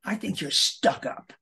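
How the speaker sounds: slow, drawn-out snooty tone; snooty sneer. Shown as a demonstration. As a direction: sneer it like a stuck-up snob. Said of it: "they may have said".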